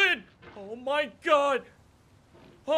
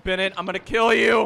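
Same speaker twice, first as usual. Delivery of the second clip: loud, fast, furious yell; screaming threat; 3 semitones lower, 10 dB louder, faster than usual. Real, not acted. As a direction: scream it into the mic in frustration. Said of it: "I'm screaming into the mic".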